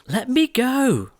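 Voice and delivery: high, slow shout. A narrator's reading. Cindy shouting at the prince to let her go. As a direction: pull away and shout it. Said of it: "As Cindy shouted".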